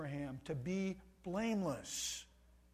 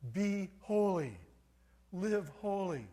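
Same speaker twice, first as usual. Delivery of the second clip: booming divine command; 5 semitones higher, 8 dB louder than usual. Acted. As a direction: thunder it down as God's own command. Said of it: "He says to his people".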